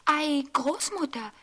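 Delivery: put-on a curious child asking; little girl voice. A narrator's reading. Rotkäppchen voice. (Rotkäppchen fragt erstaunt mit hoher Mädchenstimme)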